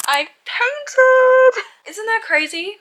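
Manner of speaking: high, loud proud announcement; excited. Real, not acted. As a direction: burst out with glee.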